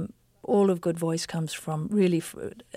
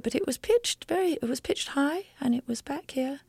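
former high-pitched voice; 8 semitones higher than usual. Shown as a demonstration. Actress showing what her untrained voice used to sound like, high-pitched. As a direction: go high, placed back, trailing to a whisper. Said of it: "I'm not sure if I could do it".

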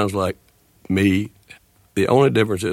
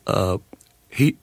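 ordinary delivery

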